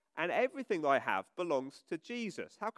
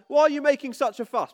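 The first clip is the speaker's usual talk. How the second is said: raised, higher cross parent voice; mock exasperated parent; 6 semitones higher, 9 dB louder than usual. Vicar acting out a parent telling children off, louder. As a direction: scold the kids like a fed-up parent. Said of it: "your mum or dad ever say".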